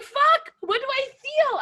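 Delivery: very high, overwhelmed exclamation; torn, retold outburst. Retelling her conflict watching the match, high frantic outburst. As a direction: re-enact frantic mixed feelings. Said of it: "I was like".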